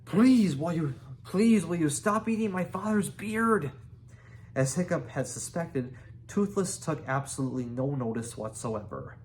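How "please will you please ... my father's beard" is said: high, loud pleading yell; boy straining to yell at dragon; yelling as loudly as possible. A narrator's reading. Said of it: "Hiccup yelled as loudly as he could".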